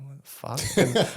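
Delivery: slow, drawn-out baffled question; confused. Retold or imitated. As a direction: stretch it out in disbelief. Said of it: "Just going".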